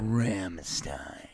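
low, soft, drawn-out murmur; teasing, hushed ASMR voice; mock ASMR. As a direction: purr it slowly into the mic. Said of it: "let me try doing A S M R".

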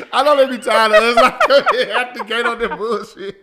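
loud, high laughing talk. Real, not acted. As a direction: talk through a big laugh. Host laughing hard while talking.

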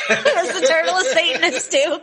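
high giggly question; laughing through words. Real, not acted. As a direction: laugh while asking. Laughing at turtle joke.

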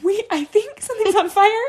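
high, laughing alarm; nervous laughing. Retold or imitated. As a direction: giggle through the fire warning. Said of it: "and I'm laughing".

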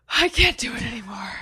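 gasping after holding breath; panting, breath finally let out. Real, not acted.

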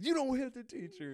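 loud, high imitated warning; retold kid's outburst. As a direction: shout it like the indignant student. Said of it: "this kid gets up and says".

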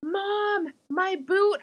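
put-on high pleading toddler voice; little girl voice. Mom quoting her three-year-old calling out, high pleading child voice. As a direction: plead like a small girl who lost her boot.

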